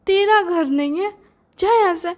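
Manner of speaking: high-pitched retold angry outburst; angry dismissal. Brother imagining telling off his sister's new husband, angry. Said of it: "इतना गुस्सा लगा ना".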